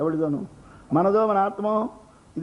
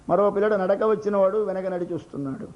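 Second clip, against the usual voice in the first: normal talking voice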